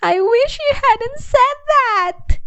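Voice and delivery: put-on high, slow, groaning child voice; groaning girl. A narrator's reading. Camilla groans back at the therapist, high and drawn out. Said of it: "Camilla groaned".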